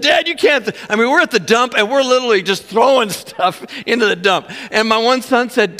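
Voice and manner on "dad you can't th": son's high protest. Retold or imitated. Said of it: "my son said".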